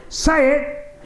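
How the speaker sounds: high excited call; mock teen demanding the catchphrase; teenage boys' shout. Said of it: "they go".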